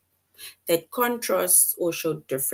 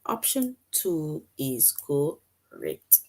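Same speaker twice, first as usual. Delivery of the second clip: slow emphatic answer; 3 semitones lower, 4 dB quieter, much slower than usual. Shown as a demonstration. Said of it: "Well done".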